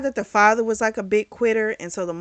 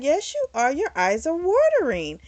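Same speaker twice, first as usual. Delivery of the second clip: put-on higher-pitched kid contradicting; insistent child; 8 semitones higher, 3 dB louder than usual. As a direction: lightly imitate a child insisting.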